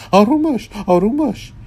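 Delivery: very high, loud put-on voice; high-voiced magician urging calm; magician character voice. A narrator's reading. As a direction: pitch it way up and call out. Magician's acted voice, calming and calling out.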